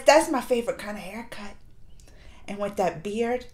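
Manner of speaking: soft, breathy confession; hushed swoon. Real, not acted. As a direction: keep it low and dreamy.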